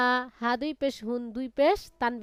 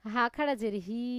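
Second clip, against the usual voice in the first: ordinary talk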